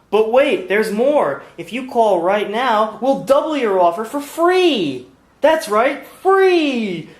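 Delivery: loud high TV pitchman hype; Billy Mays infomercial. Pretend. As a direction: sell it like a late-night infomercial. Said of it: "Uh, I'm no Billy Mays".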